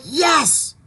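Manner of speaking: high, eager yes to a trade war; excited mock cheer. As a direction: cheer it on like you want the fight. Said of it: "And I'm like".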